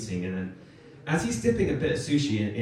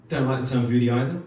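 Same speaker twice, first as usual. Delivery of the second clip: ordinary talk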